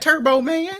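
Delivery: high, rising put-on call; mock calling cry. Calling to the film's hero, high mock cry.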